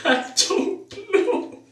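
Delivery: high, slow giggling reply; laughing. Retold or imitated. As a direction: squeak it out mid-giggle. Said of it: "I could not stop laughing".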